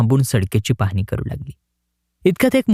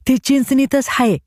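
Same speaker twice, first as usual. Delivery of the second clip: put-on much higher, innocent boy's voice; naive village boy; 10 semitones higher than usual. A narrator's reading. The boy innocently answers the collector, high and naive. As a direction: answer as if it were obvious.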